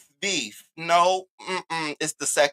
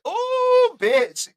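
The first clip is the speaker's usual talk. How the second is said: mock surprised ooh. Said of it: "I said".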